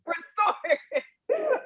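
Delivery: slow, laughing repeat of Restorative; laughing echo. Real, not acted. Repeating the host's word while laughing. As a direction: savor Restorative through a laugh.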